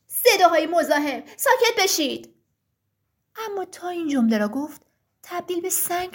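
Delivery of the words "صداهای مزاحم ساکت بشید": loud, irritated order for silence; angry shout. Acted. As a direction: yell at the pestering voices. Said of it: "برگشت و داد زد". (فریاد عصبانی)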